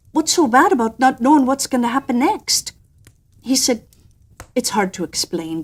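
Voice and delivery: ordinary delivery, nothing marked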